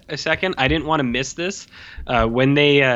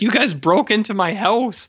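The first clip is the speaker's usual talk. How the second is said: higher-pitched mock indignation; retold indignant protest; 9 semitones higher than usual. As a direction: protest as the wronged woman, imitating. Retells woman protesting the raid.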